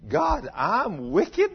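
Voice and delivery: slow astonished self-question; shocked disbelief. Retold or imitated. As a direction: gasp it out like you can't believe it's you. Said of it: "he thought".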